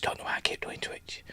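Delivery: breathy whispered aside; whispering. Real, not acted. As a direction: drop to a whisper, half to yourself. Whispered aside about platforms.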